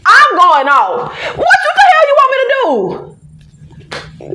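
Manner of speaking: shouting through laughter, pitch jumping high; exasperated laughing outburst. Real, not acted. Ranting about going off.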